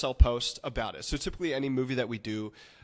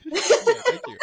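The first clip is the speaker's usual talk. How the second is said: much higher and louder, bursting with laughter; loud laughing; 10 dB louder than usual. Real, not acted. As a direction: thank him through a burst of laughter. Laughing as he takes over.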